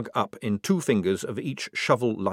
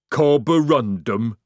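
slow troll voice; 6 dB louder, slower than usual. Acted. Troll says its name.

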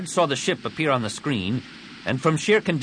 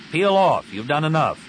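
firm order; 6 semitones higher, 4 dB louder than usual. A narrator's reading. Commander orders over comm.

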